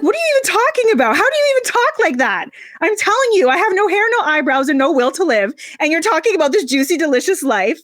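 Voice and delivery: shocked retort to her friend's pep talk; incredulous outburst. Retold or imitated. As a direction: protest as if she can't believe her ears. Retelling her disbelieving reply.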